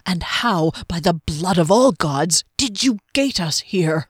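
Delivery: forceful, incredulous demand with a heavy oath; indignant demand. A narrator's reading. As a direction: demand an explanation, punching the oath. Angry demand by blood of gods.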